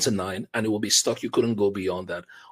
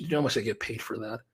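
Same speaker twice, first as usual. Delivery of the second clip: ordinary delivery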